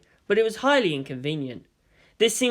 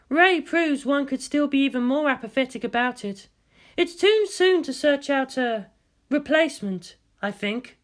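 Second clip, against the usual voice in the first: higher, lightly voiced female scientist; woman doctor voice; 9 semitones higher than usual. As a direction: lift the voice for the doctor's report.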